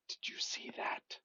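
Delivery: hushed whisper, hiding it from a listener; barely voiced, conspiratorial whisper; secretive whisper. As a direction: whisper it so only one person hears. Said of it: "I'm gonna whisper".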